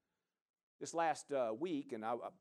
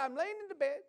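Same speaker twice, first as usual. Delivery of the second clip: put-on much higher, louder voice of the woman in her accent; imitating the woman; 8 dB louder than usual. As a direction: lift into her high, earnest voice. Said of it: "So she said".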